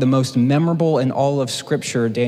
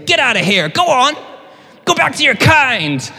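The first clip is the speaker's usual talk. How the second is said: much higher, louder, shouted shooing away; Schmidt's shooing; 11 semitones higher, 5 dB louder than usual. Retold or imitated. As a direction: drive off a wild dog. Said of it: "trying to break up with Cece by saying".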